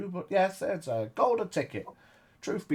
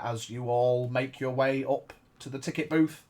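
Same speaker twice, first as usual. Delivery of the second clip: ordinary talk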